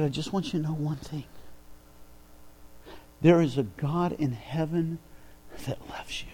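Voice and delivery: soft, hushed, earnest words to a stranger; quiet earnest telling. Retold or imitated. Preacher at a gas pump telling a stranger God loves him, soft and earnest. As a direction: lean in and say it gently, almost whispered.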